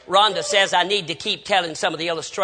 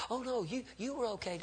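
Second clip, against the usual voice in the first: sleepy son; 5 semitones higher, 14 dB quieter than usual. Retold or imitated. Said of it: "He was about asleep".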